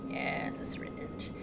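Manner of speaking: breathy whispered aside; soft unvoiced whisper. Real, not acted. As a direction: hush it like a secret. Whispering a find in game.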